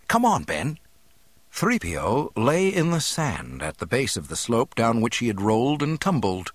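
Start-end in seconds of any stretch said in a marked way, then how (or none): 0.1-0.8 high, urgent youthful call; rally a companion to hurry; urgent young man. A narrator's reading.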